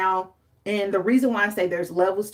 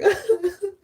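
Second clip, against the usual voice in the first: high laughing whimper; half laugh, half fake cry; giggly mock wail; mock sob laugh; 12 semitones higher than usual. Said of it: "I'm like".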